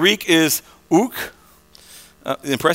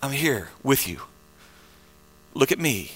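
calm reassurance; 6 semitones lower, 6 dB quieter than usual. Acted. Jesus calming the frightened Israelites, soft and reassuring. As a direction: voice sinking low and soft. Jesus calms the panicking crowd.

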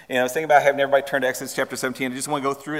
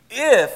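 "if" high, loud and isolated; stress on if; 7 semitones higher, 7 dB louder than usual. Shown as a demonstration. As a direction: hammer home the word "if". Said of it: "we miss that word all the time".

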